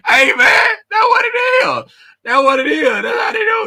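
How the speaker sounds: high, loud laughing shout. Real, not acted. Laughing hard at chat.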